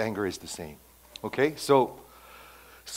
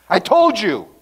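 smug complaint; 9 semitones higher, 10 dB louder than usual. Retold or imitated.

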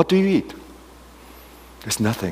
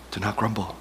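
much softer gentle repeat; quiet repeat; 3 semitones lower, 11 dB quieter than usual. Pretend. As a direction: say it again, softly. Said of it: "And Jesus said to them".

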